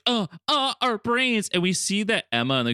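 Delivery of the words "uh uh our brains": high, feeble mock groaning; hammed-up helpless moan; mock victims. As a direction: imitate dazed pain, pitched way up. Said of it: "Xavier and Jean are like".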